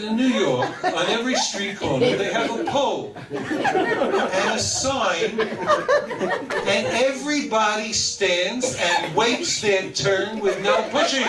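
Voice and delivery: proud explanation. Retold or imitated. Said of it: "I said".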